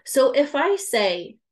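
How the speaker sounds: ordinary talk, nothing marked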